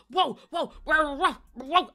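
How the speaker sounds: loud barking dog imitation; imitated woofing at the door; Ozzy. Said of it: "Ozzy's going".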